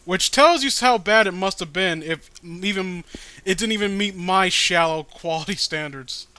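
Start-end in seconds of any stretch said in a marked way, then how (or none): none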